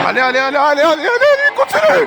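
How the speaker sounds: loud, high, screaming allez; ecstatic cheering. Real, not acted. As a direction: scream with joy as results land. Cheering as the vote comes in.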